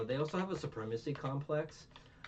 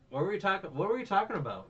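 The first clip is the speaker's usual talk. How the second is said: high, loud insistent question; loudly pressing the question; insistent repeat; 10 semitones higher, 9 dB louder, faster than usual. Real, not acted. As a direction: raise pitch and volume, demanding the lost thread. Repeats question after losing the thread.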